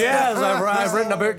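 lightly put-on posh Glasgow West End accent; light Kelvinside accent boasting about a book. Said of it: "start my Kelvin Side accent".

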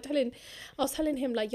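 usual speaking voice

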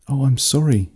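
a touch of shocked regret; apologetic. A narrator's reading. Amanda apologises after breaking leg.

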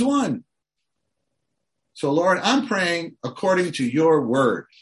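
put-on preacher modelling a bold prayer for a drifted child; bold intercessory prayer. Shown as a demonstration. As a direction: pray boldly, holding God to his word. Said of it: "We have the ground to say".

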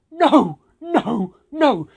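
alarmed loud refusal; panicked no. Retold or imitated. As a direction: blurt it out to stop her. Panicked refusal of lunch invite.

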